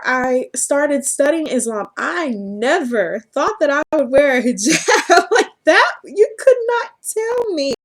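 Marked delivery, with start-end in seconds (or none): 2.0-5.9 laughing. Real, not acted. Laugh heard. 6.0-7.7 emphatic disbelief. Real, not acted.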